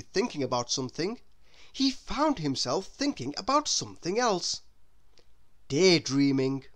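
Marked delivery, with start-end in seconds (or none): none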